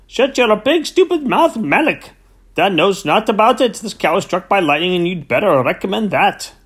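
shrill outburst of fury; raging farmer. Acted. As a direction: spit it at him in a rage. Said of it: "almost dancing with rage".

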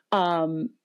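normal talking voice

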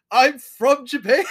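high, loud, drawn-out protest breaking into laughter; mock indignant yell. Imagined man protests racism charge, high mock yell. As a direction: yell it slowly in mock outrage.

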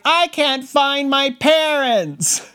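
put-on high, drawn-out child's wail; lost little boy. Eight-year-old boy to stranger, mock panicked wail. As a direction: imitate a small child crying out for help.

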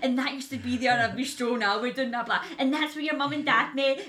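put-on high, loud, gushing pointing-out of old haunts; breathless mock sightseeing commentary; excited nostalgic tour guide. Imagined nostalgic tour for the kids.

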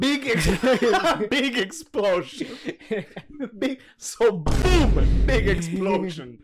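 high, loud, laughing through the words; cracking up mid phrase. Real, not acted. Retelling the Vesuvius story, laughing while repeating it.

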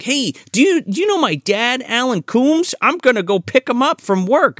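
put-on high, eager little boy voice; kid voice. Podcaster retelling the boy Jerry greeting a stranger, high child voice. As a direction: pitch it up like a small child asking.

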